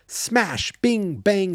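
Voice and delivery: high, punchy fight noises; sound effects. Pretend. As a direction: call out the hits.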